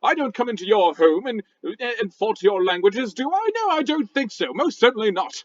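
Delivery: huffy posh British rock voice; indignant British gentleman scolding; a rock with a stuffy British accent; angry British. Acted. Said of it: "angry British sounding talk".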